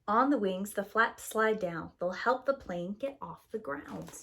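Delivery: plain reading voice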